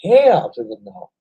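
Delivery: loud, high comic outburst; hammed-up loud protest; mock indignant refusal. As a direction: belt out a playful refusal. Retells his indignant refusal, comic.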